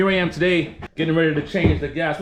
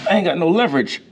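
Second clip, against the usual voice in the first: usual speaking voice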